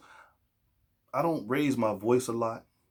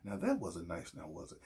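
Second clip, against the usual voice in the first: hushed, lower, halting parental talk; mock gentle reproach; 3 semitones lower, 7 dB quieter than usual. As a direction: softly chide with exaggerated tact. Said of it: "this crappy talk".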